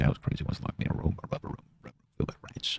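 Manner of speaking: fading to a fast whisper; rushed breathy whisper trailing off. Acted. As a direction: let the meme die out in a whisper. Meme recited fading away.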